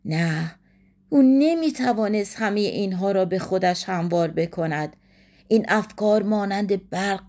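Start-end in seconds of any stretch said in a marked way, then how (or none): none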